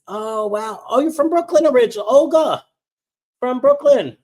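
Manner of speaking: high, loud, fast delight; thrilled someone's from Brooklyn; delighted surprise. Real, not acted. Reading a viewer's chat comment.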